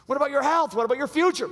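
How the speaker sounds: high, loud urgent appeal; mock pleading shout. Friend pleading with Samson, high loud appeal. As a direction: plead with him loudly.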